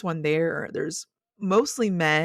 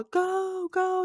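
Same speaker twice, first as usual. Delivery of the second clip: high, drawn-out "go, go" cheer; imitated cheering; 14 semitones higher than usual. Daughter cheering on the powerlifter, imitated high cheer. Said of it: "cheering her on".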